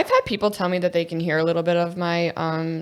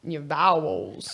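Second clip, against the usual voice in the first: slow, lower mock Southern drawl; drawn-out Southern accent; 4 semitones lower, much slower than usual. Shown as a demonstration. Co-host echoing how Southerners enunciate vowels, drawn-out Southern accent. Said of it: "everything is very drawn out".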